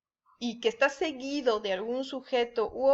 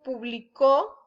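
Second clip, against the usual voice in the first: "publicó" stressed on the final CÓ, rising; stress on last syllable; 4 dB louder, slower than usual. Shown as a demonstration. Teacher showing the past tense, "publi-CÓ" stressed late. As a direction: lift and lean on the end of "publicó". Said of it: "es en pasado".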